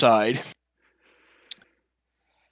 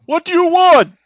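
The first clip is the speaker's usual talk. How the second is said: much higher, louder guard bellow; dopey cartoon bellow; 9 dB louder than usual. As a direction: bark it like a dim brute. Doorman voice behind door.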